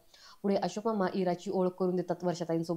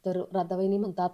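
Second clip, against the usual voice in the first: plain reading voice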